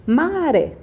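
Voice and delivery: Maré drawn out loud, stress on RÉ; stress on final syllable. Shown as a demonstration. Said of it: "não terem dúvida quanto à sílaba tônica".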